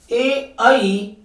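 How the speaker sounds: ए, आई stretched out, each vowel held long; very slow vowel drill; slow recitation. Shown as a demonstration. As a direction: drag out ए and आई so the class hears both. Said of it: "यानी कि आप इनको याद रखना".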